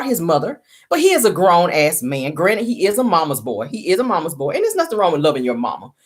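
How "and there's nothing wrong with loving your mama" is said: ordinary delivery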